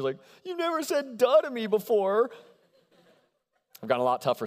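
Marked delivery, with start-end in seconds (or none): 0.4-2.3 put-on higher, tearful little-kid voice; mock teary child. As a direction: play yourself as a hurt seven-year-old. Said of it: "saying back to him through the tears".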